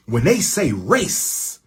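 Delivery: slow, deliberate repeat; drawn-out emphasis. Real, not acted. Speaker stressing race to listeners, slow deliberate repeat. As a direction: stretch each word to make it land.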